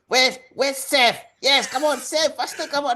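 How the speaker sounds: high-pitched impatient fan impression; mock clamouring fans. Mimicking Discord fans calling for Seth, high and mocking. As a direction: whine it up high like pestering fans. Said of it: "everyone was like".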